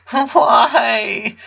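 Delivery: put-on crying boy shouting into his father's shoulder; sobbing shout. A narrator's reading. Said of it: "Clyde shouted".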